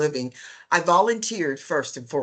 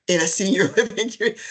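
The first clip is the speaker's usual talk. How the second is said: laughing through words; 3 dB louder, much faster than usual. Retold or imitated. Retells her disbelieving reply.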